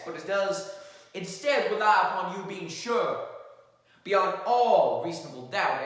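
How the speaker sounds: measured, earnest closing argument; courtroom lawyer. Acted. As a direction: address the jury with gravity.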